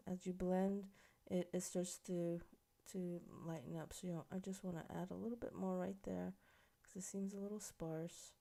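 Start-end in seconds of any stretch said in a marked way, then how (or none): none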